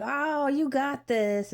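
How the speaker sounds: high, drawn-out imitation of a cheering nurse; retold cooing encouragement; encouraging. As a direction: stretch it out, high and supportive. Nurse encourages first-time dad.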